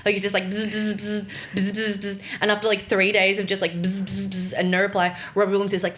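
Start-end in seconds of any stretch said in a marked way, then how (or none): 0.4-2.2 short buzzes in quick groups; imitated door buzzer. Teller mimics a director pressing a star's buzzer, imitated buzzing. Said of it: "just presses the buzzer". 3.7-4.5 three short repeated buzzes; imitated door buzzer. Three days at the gate with no reply, imitated buzzing. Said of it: "just presses the buzzer".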